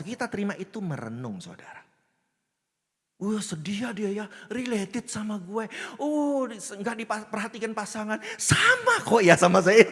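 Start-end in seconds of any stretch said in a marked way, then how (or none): 3.2-9.9 mock-moved viewer, building to a loud wail; hammed-up sympathy for a drama character; penonton terharu. (pura-pura terharu, makin heboh)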